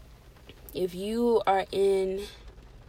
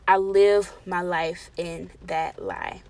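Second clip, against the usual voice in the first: ordinary talk, nothing marked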